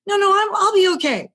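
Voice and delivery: high, loud, breezy refusal; dismissive protest. Retold or imitated. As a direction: insist you're fine in a high voice. Refusing help again.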